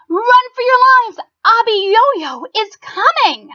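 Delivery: high, loud panicked yell; panicked shout. Acted. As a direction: scream a warning to the town. Townsfolk panic at giant.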